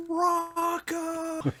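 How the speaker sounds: very high, loud yell across the con floor; yelling Rocco. Retold or imitated. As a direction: call his name as loud as you can. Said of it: "as loud as we can yell".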